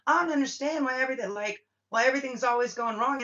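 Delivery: whiny mock complainer. Mocking the complainer again.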